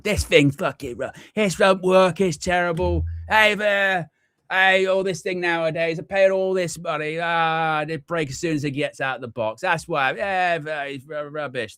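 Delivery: high, hammed-up grumpy rant; mock tech rage. Someone ranting at broken technology in front of people, hammed-up and high. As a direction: play the fool cursing a gadget. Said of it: "really get angry with a bit of technology".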